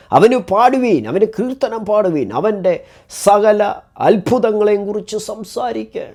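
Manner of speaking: high, loud proclaiming of the psalm; exultant psalm reading. Acted. Preacher reading the psalm to the congregation, exultant and high. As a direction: lift the verse up like a call to praise. Reads psalm call to praise.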